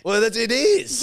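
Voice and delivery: high, loud self-defence; loud protest. Real, not acted. Protests after co-host says yuck.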